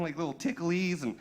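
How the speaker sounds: ordinary delivery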